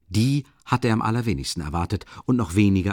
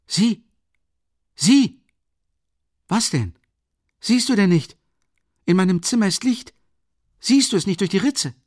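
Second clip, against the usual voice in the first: much higher and louder, urgent; alarmed; 12 semitones higher, 6 dB louder than usual. Acted. Raskolnikov urging Rasumichin to see the light, loud and high. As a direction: point it out in sudden alarm.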